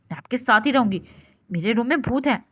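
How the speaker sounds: ordinary talk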